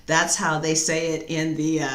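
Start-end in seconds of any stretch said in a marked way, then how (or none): none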